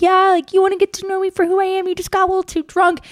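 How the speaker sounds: high-pitched put-on voice; mock agreeing. Girl falling for his crap, high placating voice. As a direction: play along in a high, airy tone.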